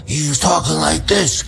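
a hint of a hoarse, rasped Brando voice; mimicking Brando's hoarse voice. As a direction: rasp it lightly the way Brando tried it. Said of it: "his voice should be very hoarse".

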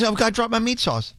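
lightly put-on voice, fretting over a spill; imitating coworker. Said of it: "that's not what she sounds like".

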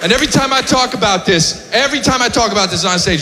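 ordinary talk, nothing marked

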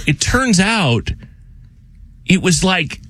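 slow, drawn-out reveal. Real, not acted. Slow delivery as he tells friends the punchline. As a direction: pause and let the punchline land.

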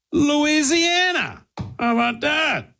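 high, drawn-out cheer; playful exclamation. Real, not acted. Host reveals the top-ranked accent, high playful exclamation. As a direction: crow it in a high, stretched voice.

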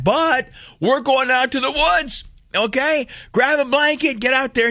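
high, rallying the troops; triumphant announcement. Real, not acted. Dad rallying the kids to the woods, pitched high and triumphant. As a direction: proclaim the trip with delight.

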